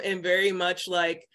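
usual speaking voice

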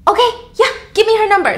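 snatch the lead eagerly. Acted. Jessica asking Taylor for the number, high eager.

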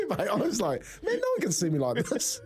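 high, laughing retelling. Real, not acted. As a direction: giggle through the line. Laughing retelling embarrassing walkout.

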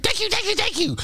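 very high squeaky thank-yous; squeaky mock thanks. Thanking Mac for the stupid Pikachu sticker, very high and squeaky. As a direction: squeak out a silly thank you.

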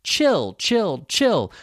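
"chill" repeated as separate words with a short I. Shown as a demonstration. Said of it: "has a short I sound".

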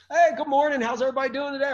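high, loud cheery hello to the courtroom; mock-sunny good morning to the court; breezy cheerful greeting. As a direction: breeze in like you own the place. Acting his cheerful court entrance.

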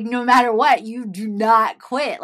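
emphatic quote. Retold or imitated. Director praising her persistence.

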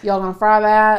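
playful Kentucky twang; Southern twang. Shown as a demonstration. Showing how she talks after visiting family, Southern twang. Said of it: "it's a twang".

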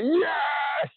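high, sharp yell of victory; triumphant yes. Retold or imitated. Survivor retelling catching a big fish, high triumphant yes. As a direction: pop out a retold cheer.

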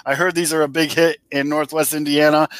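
usual speaking voice

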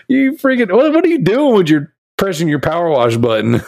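high, laughing mock scolding; half-hearted scold broken by laughter. Real, not acted. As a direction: try to tell him off through your laughter. Dad scolding son while laughing.

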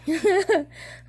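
high-pitched giggle; short high laugh. Real, not acted. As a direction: giggle at your own joke. Laughs at her own sarcasm.

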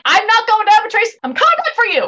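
mock-heroic declaration. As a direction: act out a high-pitched heroic promise. Acting out Vale's heroic rescue.

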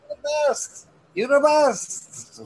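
very high, drawn-out mock cheer; mock cheering praise. Imagining the stranger's shouted praise.

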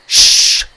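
loud unvoiced shushing; sharp hushing shh. A narrator's reading. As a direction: hush them urgently. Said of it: "Tim hushed".

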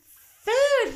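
loud, very high exclamation; excited discovery. A narrator's reading. Little Bear sniffs out food and tells Grandma, excited. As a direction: cry out at a happy find.

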